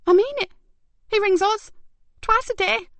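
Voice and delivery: very high, tense protest; insisting though scared; nervous insistence. Acted. Carer insisting to detectives, nervous. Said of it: "The woman bit her lip".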